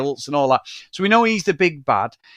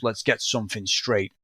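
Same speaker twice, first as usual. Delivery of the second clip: low, quiet tough-guy contractor; Hogan; 8 semitones lower, 5 dB quieter than usual. Retold or imitated. Imitating the film's private contractor to his co-host, low tough guy. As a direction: drop the voice and lay down terms. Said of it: "this is Hogan flexing his fucking artistic license".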